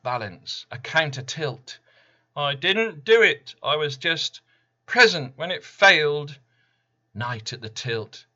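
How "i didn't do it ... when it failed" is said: high, loud, whiny protest of innocence; defensive excuse. A narrator's reading. Random denying blame after the tilt, loud whiny protest. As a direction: squeal out the excuse like a caught culprit.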